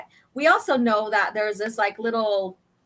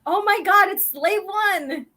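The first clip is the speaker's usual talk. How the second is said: high, hammed-up gasp of recognition; comic-book panic, pitched way up; acted shock; 9 semitones higher, 3 dB louder than usual. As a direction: cry out in mock alarm, drawing out the end. Acted shock at enemy ship.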